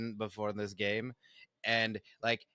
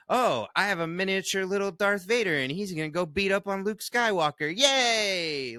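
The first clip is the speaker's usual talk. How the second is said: high, louder, gleeful cheer; mock excited newcomer; 7 semitones higher, 5 dB louder than usual. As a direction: play a thrilled beginner with his Vader. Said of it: "they can just be excited".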